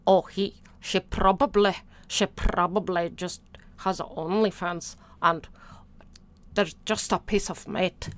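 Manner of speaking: mocking him in a Scottish accent; lightly done Scottish brogue. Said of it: "That's my Scottish accent".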